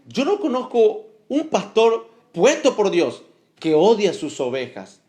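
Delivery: ordinary delivery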